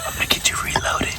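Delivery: loud stage whisper; urgent whispered instruction. Real, not acted. Mentor whispering in blind.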